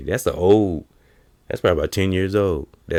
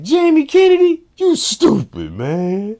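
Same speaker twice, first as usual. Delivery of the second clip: very high, loud mock insult; 6 dB louder, slower than usual. Fans greeting Jamie Kennedy with insults, squeaky high mock voice.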